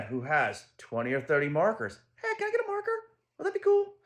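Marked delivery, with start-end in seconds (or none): 2.2-3.9 put-on much higher, sweet small-girl voice; little girl asking. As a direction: ask sweetly like a young daughter. Daughter asks boy for marker.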